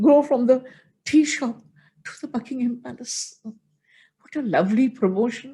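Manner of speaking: preacher overcome with pride telling listeners about the Prime Minister; emotional pride. Real, not acted. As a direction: let real emotion lift the voice up and out. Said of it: "Sorry for being emotional".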